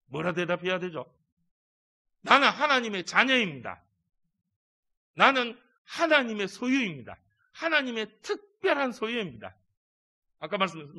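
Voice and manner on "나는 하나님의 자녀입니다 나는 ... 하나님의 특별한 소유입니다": proud declaration. Acted. Congregation's answer voiced.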